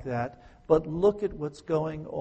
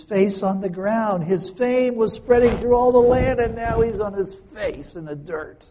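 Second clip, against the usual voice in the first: higher, louder preaching climb; full-force preaching; 7 semitones higher, 8 dB louder than usual. Acted. Preacher contrasting Joshua's fame with his fall, high and loud. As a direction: drive the contrast home to the congregation.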